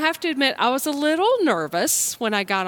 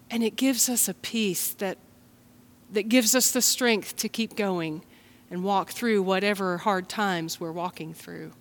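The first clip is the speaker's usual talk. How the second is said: ordinary talk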